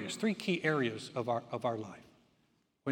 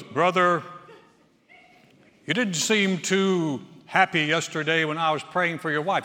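louder, lightly voiced confrontational brother; loud mock churchman; 7 dB louder than usual. Churchman confronting the preacher at the conference, loud mock voice. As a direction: play the man calling him out. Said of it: "he goes".

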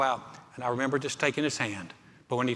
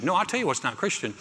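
ordinary delivery

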